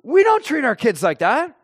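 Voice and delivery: a mother horrified at the slap; shocked wife. Retold or imitated. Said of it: "the wife says".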